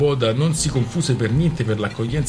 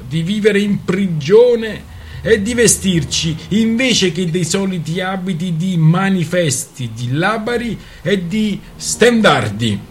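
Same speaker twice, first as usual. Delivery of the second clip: louder, higher, slow sarcastic tirade; raised voice to silence the speaker; raised mocking voice; 8 semitones higher, 5 dB louder, slower than usual. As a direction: shout the orator down with parody. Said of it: "continuò alzando la voce per ridurre al silenzio l'oratore".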